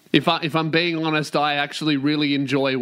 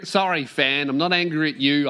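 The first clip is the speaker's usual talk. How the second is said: normal talking voice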